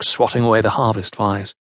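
ordinary delivery, nothing marked

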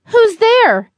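high, loud challenge to someone unseen; calling out. A narrator's reading. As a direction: call out insistently, pitched high. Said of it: "he repeated".

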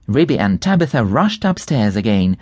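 ordinary delivery, nothing marked

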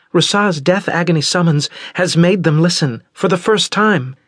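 put-on high, tense young woman's voice; young Osira. Daughter explaining the pharaohs to parents, high and tense. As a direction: lift into a high worried girl.

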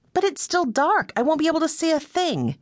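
high, worried Meg protesting the dark; fretful Meg. A narrator's reading. Meg objects in the dark plane.

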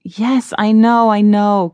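loud, exasperated interruption; impatient cry. A narrator's reading. Donald interrupts the boasting elf, crying out impatiently. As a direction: cut in loudly to stop the boasting. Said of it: "cried Donald".

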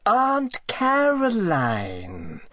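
high, slow, voiced insistence on the name; irritably. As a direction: drag the name out, high and pointed. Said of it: "said the lady irritably".

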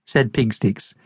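ordinary delivery

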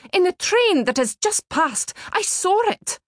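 insist, voice climbing high; vehement. A narrator's reading. Old lady insisting to the ticket collector, pitch climbing high. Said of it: "turned to him with vehemence".

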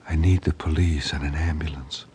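barely voiced, breathless whisper; a wounded woman straining to be heard. A narrator's reading. Wounded wife whispering to dispatcher.

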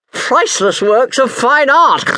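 high, loud squeal. A narrator's reading. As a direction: squeal it out in a shrill piggy voice. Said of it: "squealed Milton Melton Mowbray".

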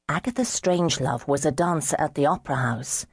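plain reading voice

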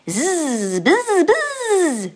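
high buzzing bumblebee; buzzing bee. Acted. The bumblebee greets the mouse with a high buzz.

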